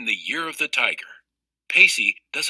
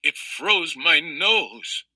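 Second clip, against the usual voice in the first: put-on slow, higher, teeth-chattering kid voice; shivering; 4 semitones higher, much slower than usual. A narrator's reading. Girl back inside from the freezing wind, slow shivering. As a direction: shiver through each word slowly, just in from the cold. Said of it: "I said, shivering".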